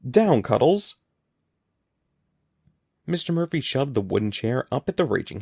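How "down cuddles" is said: loud, higher-pitched desperate order; shouted command. A narrator's reading. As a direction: shout it at a raging animal. Teacher shouting at the hamster.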